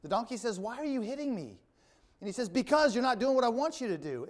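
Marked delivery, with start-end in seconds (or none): 0.6-1.6 lightly voiced donkey complaint, pitched high; acted protest. Donkey asks Balaam why he hits her, high-pitched protest. 2.5-4.3 mock-irritated retort, high and louder; acted exasperation. Balaam argues back at his donkey, acted exasperation.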